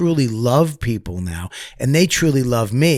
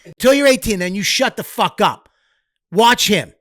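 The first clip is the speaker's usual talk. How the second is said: angry father; 8 semitones higher than usual. Retold or imitated. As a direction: bark it like an enraged dad. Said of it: "a father would have been like".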